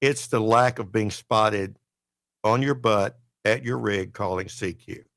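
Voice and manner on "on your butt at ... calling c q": ordinary delivery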